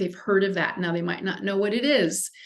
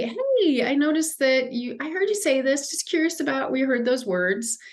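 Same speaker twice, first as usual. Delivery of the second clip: gentle, higher, curious questioning tone; calm and kind; 6 semitones higher than usual. Shown as a demonstration. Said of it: "to really calmly and kindly say".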